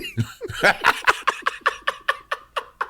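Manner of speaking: breathless high wheezing laugh; high airy laugh. Real, not acted. Host cracking up at the mock impression, airy wheeze.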